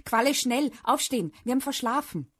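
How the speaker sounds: high, loud, hurried wake-up call; agitated. A narrator's reading. Dad waking child after oversleeping, agitated. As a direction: rouse the sleeper in a panic rush. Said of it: "weckte mich eine aufgeregte Papastimme".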